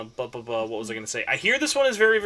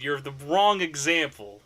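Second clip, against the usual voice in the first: ordinary talk, nothing marked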